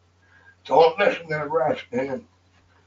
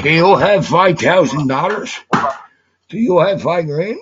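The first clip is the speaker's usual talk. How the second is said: pressing hard and loud for the money; louder, insisting he pay up; loud demand; 2 semitones higher, 8 dB louder than usual. Real, not acted. Demanding five grand from the player, loud and insistent.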